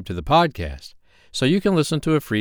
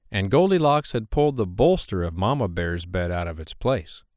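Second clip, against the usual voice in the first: plain reading voice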